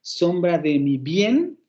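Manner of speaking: "Sombra de mi bien" slowly, with BIEN stretched and rising; slow build onto a long, rising BIEN; stressed final syllable. Shown as a demonstration. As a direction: draw out "bien" at the end of "Sombra de mi bien". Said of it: "Tenemos tres acentos".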